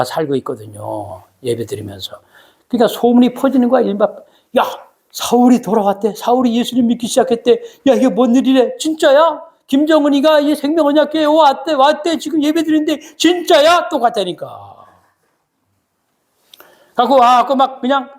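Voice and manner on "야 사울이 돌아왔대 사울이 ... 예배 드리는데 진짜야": high, breathless rumor-spreading; excited gossip. Acted. Believers in Damascus spreading rumor, excited high gossip. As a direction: pass on the shocking news, higher and higher.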